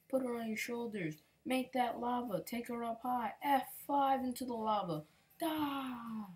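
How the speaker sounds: egging on his wrestler to finish her; hyped. Real, not acted. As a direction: gamer hyping his wrestler's finisher, excited. Hyping up his finisher move.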